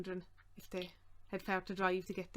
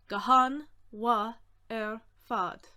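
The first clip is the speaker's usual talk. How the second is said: each word separate and projected; very deliberate, gaps between words; slow breakdown; 8 dB louder, slower than usual. Shown as a demonstration. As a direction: sound it out slowly for learners.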